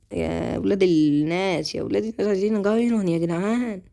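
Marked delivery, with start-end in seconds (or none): none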